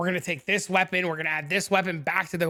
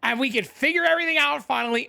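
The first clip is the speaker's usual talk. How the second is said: real excitement; 7 semitones higher than usual. Real, not acted. Streamer hyping his viewers about the new tool, excited and higher. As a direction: gush about it, genuinely thrilled. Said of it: "I'm so excited".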